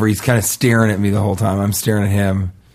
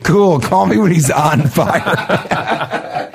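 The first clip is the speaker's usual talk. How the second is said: laughing mock fireman; 5 semitones higher, much slower than usual. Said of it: "They're like".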